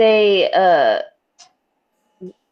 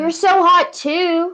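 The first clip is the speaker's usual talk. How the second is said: mock swooning compliment; 10 semitones higher, 6 dB louder, slower than usual. Gushing compliment to co-host.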